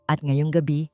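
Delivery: plain reading voice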